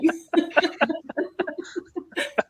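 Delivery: high bright laughter; amused laugh. Real, not acted. Laughs at the accent.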